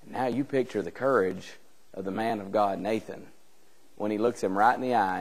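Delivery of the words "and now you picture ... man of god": ordinary talk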